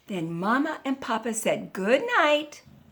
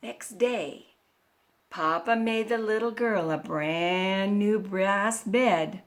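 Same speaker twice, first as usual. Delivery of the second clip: ordinary delivery, nothing marked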